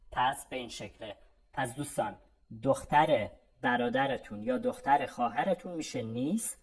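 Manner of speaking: normal talking voice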